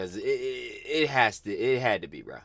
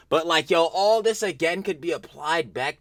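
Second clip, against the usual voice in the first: loud excited; 6 semitones higher, 5 dB louder than usual. Real, not acted.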